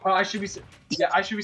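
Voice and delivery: ordinary talk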